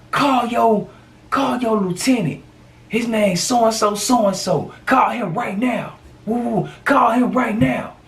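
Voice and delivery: hammed-up high demand; imitating demanding friend. Friend demanding cops call their lieutenant.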